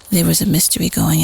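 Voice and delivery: usual speaking voice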